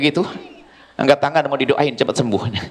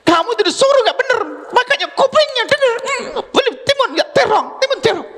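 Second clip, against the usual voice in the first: mock furious mother. Mother yelling at child who bought eggplant, mock furious. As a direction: rant at the kid over the cucumber.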